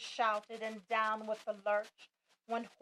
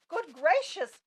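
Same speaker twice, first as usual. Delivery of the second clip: startled gasp, louder and higher; 6 semitones higher, 8 dB louder than usual. A narrator's reading. Maisie gasping as she spots Horton, startled. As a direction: gasp in surprise at the sight. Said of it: "gasped Maisie".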